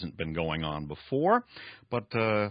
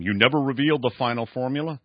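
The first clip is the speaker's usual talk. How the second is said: dramatic reading; 7 semitones higher, 7 dB louder, faster than usual. Acted. Reads novel dialogue aloud.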